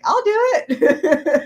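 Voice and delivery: high, loud, drawn-out offer; mock eager volunteering. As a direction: play gleefully volunteering for mischief. Retold eager offer to do mischief.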